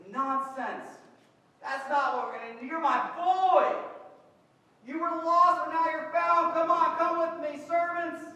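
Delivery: loud, high call, then yelling for servants; joyful father shouting. Retold or imitated. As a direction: tell him off kindly, then call the servants. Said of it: "Starts yelling for the servants".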